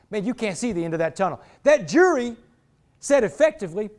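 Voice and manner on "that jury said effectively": ordinary talk, nothing marked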